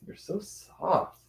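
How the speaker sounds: high, soft, drawn-out coo; cooing pet talk. Real, not acted. Owner petting a soft cat, slow high cooing. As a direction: coo it slowly, high and softly.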